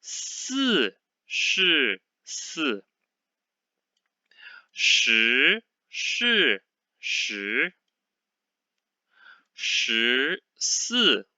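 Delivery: each syllable drawn out and set apart; very slow reading. Shown as a demonstration. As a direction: read it very slowly, one syllable at a time. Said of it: "very slowly".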